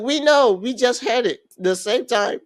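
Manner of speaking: excited squeal. Retold or imitated. Girls gloating.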